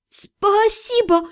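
high, drawn-out wobbly thanks; bleating. A narrator's reading. Slapped mage thanking while suppressing hysteria, high bleat. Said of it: "проблеяла я, загоняя истерику внутрь".

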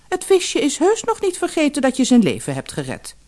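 hoog ingezet, licht gespeelde vrouw; vrouw van de visser. Acted. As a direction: een beetje zeurende visserswijf. Vrouw spreekt. (hoge stem van de vissersvrouw)

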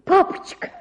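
сдавленный высокий вскрик почти без голоса; выдохнуть зов отцу высоко и беззвучно; крик. A narrator's reading. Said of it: "закричала я снова". (девочка в ужасе зовёт отца сдавленным вскриком)

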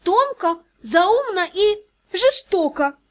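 high, slow whining complaint; plaintive whine. Acted. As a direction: sulk and whine it out. (жалобно, нараспев)

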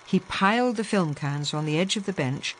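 ordinary delivery, nothing marked

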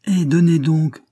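high, loud impatient cry; snatch it, eager and loud; impatient outcry for the letter; exclaiming. A narrator's reading. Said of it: "s'écria madame Dalbreuse".

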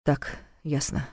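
low, slow mumble; mutter. Acted. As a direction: mumble it half to yourself. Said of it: "пробормотал Влад".

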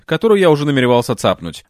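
usual speaking voice

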